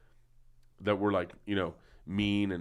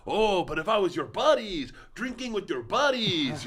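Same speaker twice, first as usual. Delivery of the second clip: much higher, slow put-on dad voice; imitating Freddie Soto's dad; 9 semitones higher, slower than usual. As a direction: squeak it out like a sulking dad. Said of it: "Freddie Soto had that joke about his dad".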